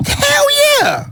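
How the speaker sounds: very high, loud yell of agreement; real high-pitched shouted yes; emphatic shout. Real, not acted. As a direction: yell it out high, fully worked up. Emphatic answer in the heated outburst.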